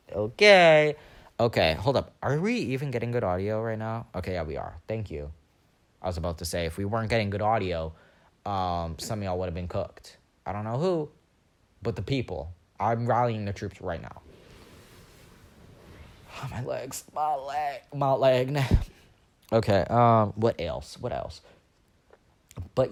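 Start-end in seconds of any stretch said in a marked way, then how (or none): 0.1-1.0 loud, higher, stretched-out okay; exaggerated okay. Acted reaction to frozen tears remark. 16.4-18.6 complaining of sore legs. Real, not acted. Complains of own legs while sitting.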